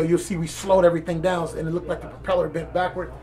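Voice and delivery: ordinary talk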